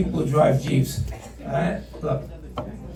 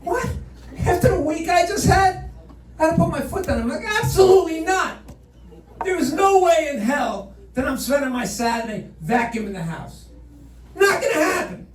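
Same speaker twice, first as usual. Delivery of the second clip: higher, indignant mock outburst; retold angry refusal; 7 semitones higher than usual. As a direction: play his own angry refusal, hammed-up. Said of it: "I got mad".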